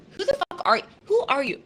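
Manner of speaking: a playful fake outburst; mock indignant. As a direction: pretend to be insulted, jokingly. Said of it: "I'm just kidding".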